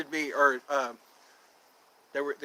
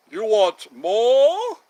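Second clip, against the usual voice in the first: slow, louder, disbelieving bellow; outraged master; 4 semitones higher, 8 dB louder, much slower than usual. Retold or imitated. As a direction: drag it out in mock outrage. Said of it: "Oliver Twist".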